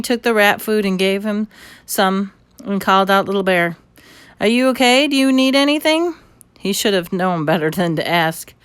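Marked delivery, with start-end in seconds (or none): 4.4-6.1 put-on boy calling out. A narrator's reading. Boy calls to toy Indian.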